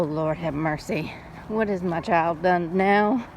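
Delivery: usual speaking voice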